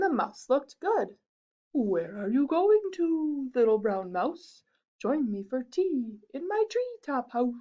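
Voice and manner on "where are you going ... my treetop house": wise owl. Acted. Owl voiced.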